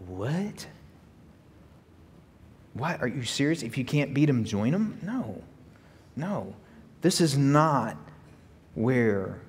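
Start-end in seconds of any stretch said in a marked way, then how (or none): 0.1-6.5 stunned disbelief. Real, not acted. Preacher reacts in disbelief.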